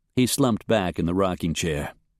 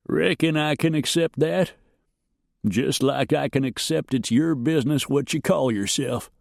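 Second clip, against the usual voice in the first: put-on old farmer, suspicious; 5 semitones higher than usual. A narrator's reading. Peabody accepts Luke's answer.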